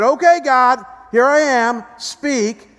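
loud, drawn-out demanding prayer; mock impatient prayer. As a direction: order God to speak, half-mocking.

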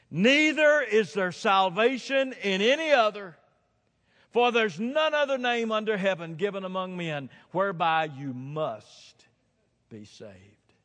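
high, loud, ringing scripture quotation; declaimed scripture. Retold or imitated. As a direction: proclaim the verse at full force. Declaims scripture at full force.

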